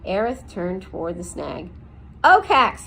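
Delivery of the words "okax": loud, higher bellow across the clearing. A narrator's reading. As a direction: bellow his name at the tree. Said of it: "he bellowed".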